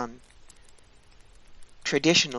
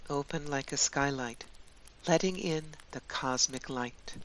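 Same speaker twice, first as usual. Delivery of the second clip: guided meditation; 7 dB quieter than usual. Acted. Guide leading listeners into a Yule winter journey, guided meditation. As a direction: guide them gently, leaving long spaces.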